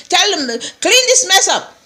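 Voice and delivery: high-pitched, upset mock order. Mother can't punish master's son, upset high mimicked order. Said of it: "Very upset".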